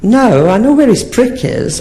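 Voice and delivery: high, slow prim lady voice; high mock woman. Imitating a prim woman explaining to the comic, high.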